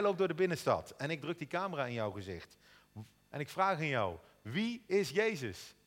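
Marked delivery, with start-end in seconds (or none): 4.4-5.7 slow interview question. Pretend. Street interviewer asks question.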